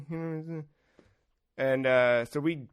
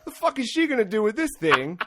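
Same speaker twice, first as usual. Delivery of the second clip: much higher, louder mock disbelief; incredulous inner voice; 9 semitones higher, 4 dB louder than usual. Comic reacting to the feeble lady helping, high incredulous. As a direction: squeal the disbelief at her asking. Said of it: "I'm like".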